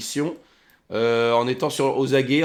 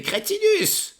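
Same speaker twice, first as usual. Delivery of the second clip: much higher, bossy villain voice; high commanding NPC voice; 11 semitones higher, 3 dB louder than usual. Acted. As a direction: bark orders in a high voice. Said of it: "Elle fait".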